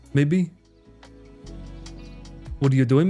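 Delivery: ordinary talk, nothing marked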